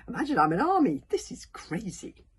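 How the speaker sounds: high, loud exasperation; incredulous protest. A narrator's reading. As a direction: repeat it in disbelief, complaining. Fishlegs protests the absurd plan.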